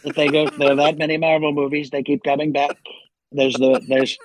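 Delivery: lightly voiced boastful president impression; Donald Trump. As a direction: play a rambling, self-congratulating president. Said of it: "back to Trump".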